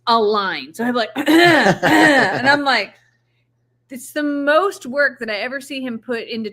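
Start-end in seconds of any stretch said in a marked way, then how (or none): 0.7-2.9 loud, exaggerated throat clearing; hammed-up throat clearing before an important call; mock throat clearing. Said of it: "he clears his throat".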